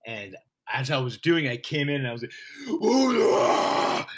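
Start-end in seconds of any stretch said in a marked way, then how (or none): 2.7-4.1 high, loud cartoon devil whoop; cartoonish Satan cry. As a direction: go full cartoon villain with the cry. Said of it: "I just spoke like that".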